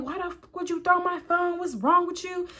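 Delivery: high-pitched, heated confrontation; retold angry outburst; mad, arguing. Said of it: "So now I'm mad".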